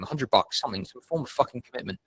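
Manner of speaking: usual speaking voice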